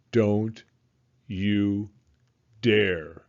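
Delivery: low, slow, loud word-by-word threat; menacing crayon. A narrator's reading. The crayon warning Jasper from the toilet, low and menacing. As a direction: space out each word like a threat.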